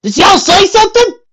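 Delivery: high, loud call over the shoulder; calling back. A narrator's reading. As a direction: shout back through the wind. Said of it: "called over her shoulder".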